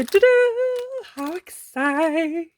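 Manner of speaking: playful singsong excitement; excited reveal. Pretend. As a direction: sing out the excitement, stretching the words. Playful reveal of matching punch.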